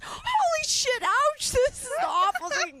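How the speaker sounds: high, pained yelp at the stubble burn; mock pained yelp. Recalling a guy's stubble, she yelps high in pain. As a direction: cry out high at the scratchy stubble. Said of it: "and I was like".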